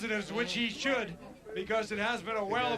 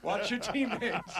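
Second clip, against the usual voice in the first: laughing; slower than usual. Retold or imitated. Laughing while quoting coach.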